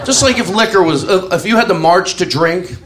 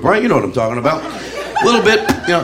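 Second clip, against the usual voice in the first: normal talking voice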